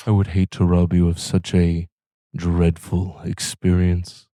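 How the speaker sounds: measured vampire lord, drawing out the end; Vampire Lord-esque voice. Acted. Possessed devil addressing his victim, measured vampire lord voice. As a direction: speak as a vampire lord, deliberate and mock-courteous. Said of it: "in a very familiar Vampire Lord-esque voice".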